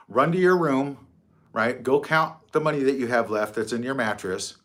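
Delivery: normal talking voice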